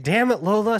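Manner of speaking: high, loud mock complaint; exasperated. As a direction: groan at grandma in fake outrage. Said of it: "And I'm just like".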